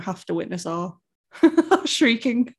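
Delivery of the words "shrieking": the word breaks into a laugh. Real, not acted. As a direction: giggle it out. Laughs while saying shrieking.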